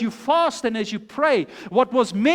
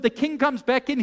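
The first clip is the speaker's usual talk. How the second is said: ordinary talk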